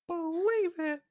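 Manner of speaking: high, excited anime shout; Naruto catchphrase. Retold or imitated. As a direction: yell it like a ninja kid. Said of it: "I like the Naruto bit".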